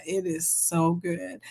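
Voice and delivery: ordinary talk, nothing marked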